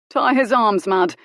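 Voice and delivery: barked like an officer, high and loud; military order. Acted. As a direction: command her like a subaltern. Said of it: "Colin ordered her".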